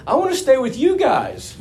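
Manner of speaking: put-on high child voice with a laugh breaking in; lightly voiced little boy, laughing; child's punchline. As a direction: deliver the kid's line while cracking up. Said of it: "He goes".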